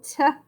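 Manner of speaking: "t-a" as a short t, pause, then a held long A; sounded out. Shown as a demonstration. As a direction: sound out t-a, stretching the A as in "day". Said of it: "You hear that long A?".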